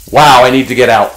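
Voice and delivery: ordinary talk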